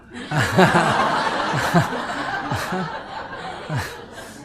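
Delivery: long breathy laugh. Real, not acted. Monk laughs at his own mock lecture to mom, breathy laugh.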